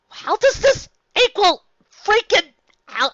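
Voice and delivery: usual speaking voice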